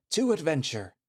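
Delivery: loud, high rallying cry; excited cheer. Acted. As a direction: shout it to the road ahead. Friend cheers as they set off.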